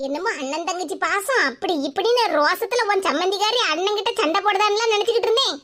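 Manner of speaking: ordinary talk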